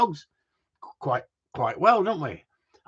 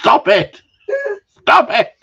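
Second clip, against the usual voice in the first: laughing shout; 13 semitones higher, 11 dB louder, slower than usual. Real, not acted. Laughing at a cheeky comment.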